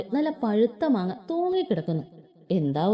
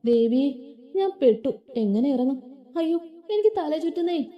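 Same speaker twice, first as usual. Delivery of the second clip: stuck and dizzy; 4 semitones higher than usual. Acted. Girl stuck up a tree, dizzy.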